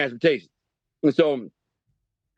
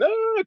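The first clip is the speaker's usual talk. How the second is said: mock cry. Girl hit, crying out.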